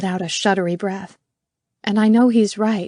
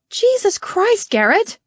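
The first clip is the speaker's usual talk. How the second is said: high, loud, drawn-out yell; 14 semitones higher, 5 dB louder, slower than usual. Acted. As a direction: yell his name in exasperation. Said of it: "and yell".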